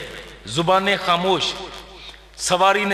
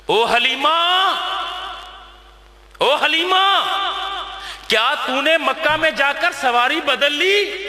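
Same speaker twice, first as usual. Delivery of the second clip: calling from behind, higher and drawn out; women of the caravan calling to Halima; 5 semitones higher, slower than usual. Acted. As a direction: hail a friend across the caravan.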